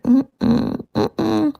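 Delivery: soft high hummed no-no; wife hums a soft, high refusal to husband; dismissive hum. Retold or imitated.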